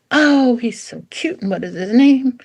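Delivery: acted dog-lover's coo; gushing stranger. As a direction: gush like a stranger meeting an adorable dog.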